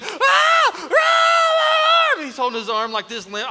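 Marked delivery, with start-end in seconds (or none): 0.0-2.1 high, very loud mock screaming; imitated child screams. Toddler hurt after a couch fall, loud mock wail. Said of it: "He's screaming".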